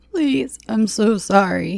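high, slow tearful plea; crying apology. A narrator's reading. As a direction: cry out for forgiveness, drawn out. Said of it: "he cried".

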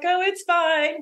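high, airy pretence of being fine; feigned breeziness. Retold or imitated. Swimmer hiding misery from husband, feigned breeziness.

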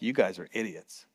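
low, soft, unimpressed ranger voice; deadpan ranger. Retold or imitated. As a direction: flatly tell the kids they're idiots. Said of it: "he's like".

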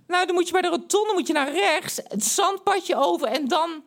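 very high, squeaky pre-puberty voice; put-on high-pitched boy voice; unbroken boyish voice. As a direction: talk as if your voice hasn't broken yet. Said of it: "de baard nog niet in de keel".

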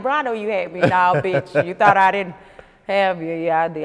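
loud, hammed-up back-and-forth argument; mock pleading with God. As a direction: argue loudly, acting out a talk with God. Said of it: "you be talking to God like".

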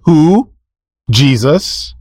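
ordinary delivery

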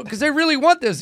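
lifted high, eager; high pleading voice. Retold or imitated. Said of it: "I go".

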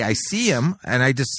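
normal talking voice

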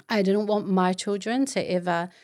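ordinary talk

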